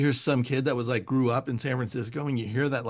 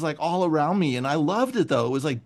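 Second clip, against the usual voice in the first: usual speaking voice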